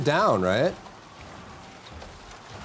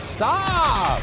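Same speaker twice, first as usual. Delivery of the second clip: high yelp; 10 semitones higher than usual. Acted. Shouting at enemy.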